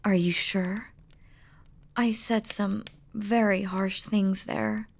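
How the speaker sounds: usual speaking voice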